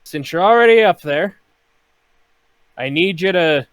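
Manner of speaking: loud, high bosun calling up to the rigging; bosun barking orders. Acted. Bosun calling up to Jason in the rigging, loud barked order. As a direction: shout the order up the mast. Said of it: "Mister Scourge looks up at you, and he says".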